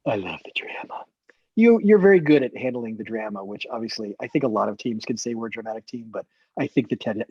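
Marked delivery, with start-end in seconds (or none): 0.1-1.0 near-whispered, low echo; hushed aside. Real, not acted. Teammate repeating that they love the drama, hushed. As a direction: drop to a soft whisper.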